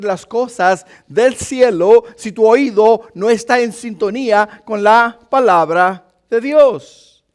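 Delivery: loud, high fiery preaching. Acted. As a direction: preach with full force. Preaching builds to full force.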